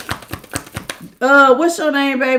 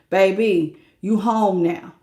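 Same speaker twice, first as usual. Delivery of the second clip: soft, lower, soothing partner voice; gentle lowered voice, softer; tender partner; 5 semitones lower, 4 dB quieter than usual. Retold or imitated. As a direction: soothe them home after work. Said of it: "gonna remind me".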